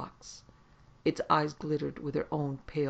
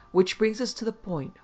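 higher, louder, businesslike start; brisk; 7 semitones higher, 7 dB louder than usual. A narrator's reading. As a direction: cut in briskly to get to business. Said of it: "Hogue came in briskly".

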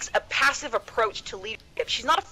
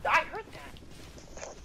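whisper; 4 semitones higher, 10 dB quieter, much slower than usual. Real, not acted. Aside after calling kids.

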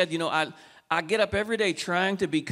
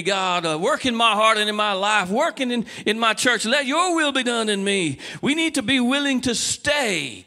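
loud, pleading pulpit prayer; impassioned altar prayer; fervent preaching prayer; 3 semitones higher, 4 dB louder than usual. Acted. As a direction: cry out to God to change you.

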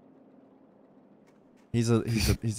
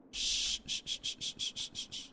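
soft, fading shushes; shushing; 16 dB quieter than usual. Acted. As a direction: hush someone gently.

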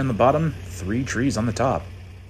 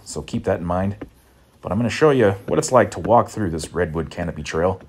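panting between words; winded from climbing stairs; out of breath; 4 dB louder than usual. Real, not acted. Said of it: "sorry about all the huffing and puffing".

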